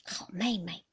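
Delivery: subdued, swallowed plea; choked-back call. A narrator's reading. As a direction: call to her under your breath. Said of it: "只是吞声叫". (低声下气地轻唤)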